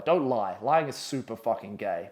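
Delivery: ordinary delivery, nothing marked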